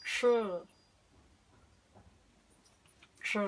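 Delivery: "sh" como un siseo suave y sostenido; sonido sh. Shown as a demonstration. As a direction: sopla "sh" con los dientes juntos. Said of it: "sería". (sonido "sh" sostenido)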